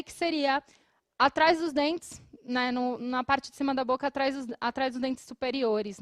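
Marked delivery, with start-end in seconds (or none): none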